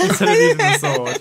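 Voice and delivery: loud, high squeal of laughing words; helpless laughter. Real, not acted. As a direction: crack up while finishing. Laughing at own game mistake.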